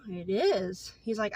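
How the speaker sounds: ordinary talk, nothing marked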